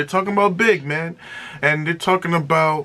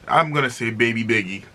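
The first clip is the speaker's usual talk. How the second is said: ordinary talk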